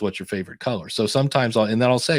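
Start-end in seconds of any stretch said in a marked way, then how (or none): 0.0-0.9 repeated a little softer and lower; restated under the breath. Shown as a demonstration. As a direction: say it again quietly, as an aside. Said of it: "which was".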